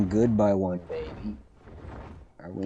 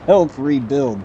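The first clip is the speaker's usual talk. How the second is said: ironic air quotes; 5 semitones higher, 8 dB louder, slower than usual. Real, not acted. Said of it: "quote unquote".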